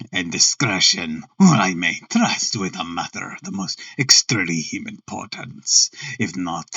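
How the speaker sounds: low, harsh German nobleman; deep German-accented aristocrat voice. Acted. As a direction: speak formally as a German count, pitched well down. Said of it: "harsh voice with a strongly marked German accent".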